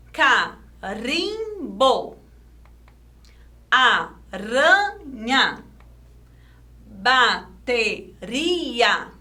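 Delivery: very slow, "carimbo" broken into ca-rim-bo with rolled R; syllable by syllable. Shown as a demonstration. Teacher sounding out example words, syllable by syllable. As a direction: split each word with pauses, trilling the R in "aranha". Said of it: "Agora vamos ler sem silabar".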